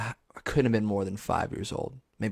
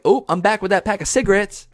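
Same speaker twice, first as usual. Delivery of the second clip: loud, higher-pitched mock cheery dad; returning dad; 9 semitones higher, 6 dB louder than usual. Absent dad returning to his grown famous kid, mock casual. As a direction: announce it like a dad casually back. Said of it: "your dad's like".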